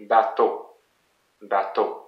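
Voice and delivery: French "bateau", weight on "teau"; last-syllable stress. Shown as a demonstration. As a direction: model "bateau" stressed at the end. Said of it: "it's the last one that is the most emphasized".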